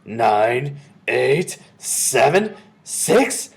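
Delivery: loud witch's count, each number separate; building countdown. A narrator's reading. Grand High Witch counts down final seconds, loud and mounting. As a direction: count out loudly, rising in tension.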